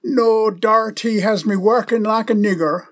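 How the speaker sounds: imitating a neighbour's West Indian accent. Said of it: "He replied in a heavy West Indian accent".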